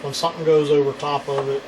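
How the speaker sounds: ordinary delivery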